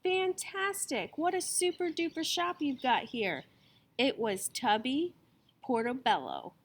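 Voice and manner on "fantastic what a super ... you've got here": gushing. A narrator's reading. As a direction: gush over the shop. New customer gushing.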